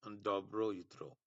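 very slow, each syllable of Dobro jutro drawn out; slow pronunciation demo. Shown as a demonstration. Teacher showing Bengali students Serbian good morning, very slow. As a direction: spell out Dobro jutro slowly for learners. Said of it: "তার মানে ওরা good morning কে বলে".

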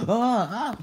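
high, loud yelps of fright; startled screams. Acted. Asking what's going on here, startled screams. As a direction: yelp as it startles you.

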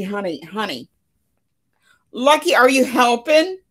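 ordinary delivery

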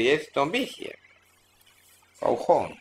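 ordinary talk, nothing marked

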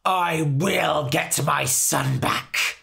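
loud, slow furious vow; shouted vow. Acted. Fairy King shouts a vow to reclaim his son, slow and loud. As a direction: swear it in a rage. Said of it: "he shouted".

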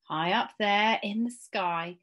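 ordinary delivery, nothing marked

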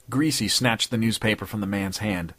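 ordinary delivery, nothing marked

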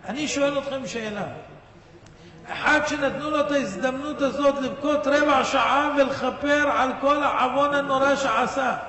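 ordinary talk, nothing marked

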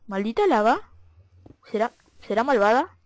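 ordinary talk, nothing marked

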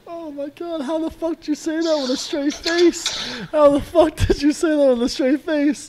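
can't believe he said that with a straight face; incredulous disbelief. Real, not acted. Mocking friend's straight-faced bet.

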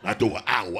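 low boast; a hint of a smug baller; bragging baller. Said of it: "volunteered the information".

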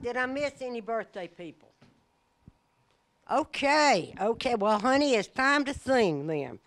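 ordinary talk

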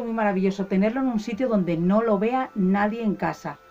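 ordinary delivery

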